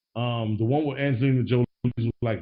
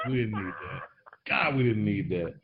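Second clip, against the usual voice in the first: normal talking voice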